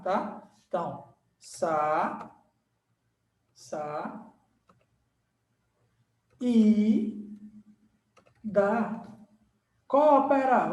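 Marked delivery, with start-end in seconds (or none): 1.4-9.1 slow, split into syllables. Shown as a demonstration. Teacher splitting saída for the class. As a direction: break the word sa-i-da apart. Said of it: "vamos separar a saída".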